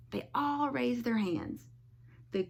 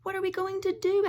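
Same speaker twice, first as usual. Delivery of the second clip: worried; 9 semitones higher, faster than usual. Acted. Callie panics.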